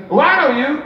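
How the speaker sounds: a mock celebrity perking up; Eddie Murphy impression. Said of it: "I'm gonna do a terrible impersonation".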